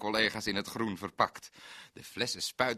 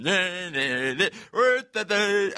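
mock protest; 9 semitones higher, 8 dB louder, much slower than usual. Laughing denial of singing.

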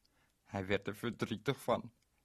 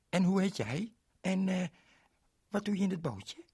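put-on much higher, louder little girl voice; 9 semitones higher, 4 dB louder than usual. Ling introduces herself to Berend, high girlish voice. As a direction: play a bright small girl. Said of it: "zei het meisje".

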